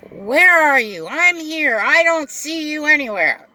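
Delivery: the impatient customer shouting from her driveway. Retold or imitated. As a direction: mimic the passenger, pushy and shrill-pitched. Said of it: "And she's like".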